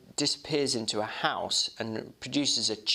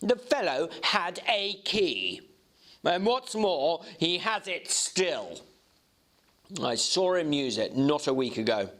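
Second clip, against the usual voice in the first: touch of sullenness; 8 semitones higher than usual. A narrator's reading. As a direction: let a little sulk creep into it. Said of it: "with a touch of sullenness".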